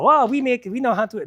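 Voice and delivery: put-on hammy German accent, high and loud; bad German accent. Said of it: "this is bad German accent".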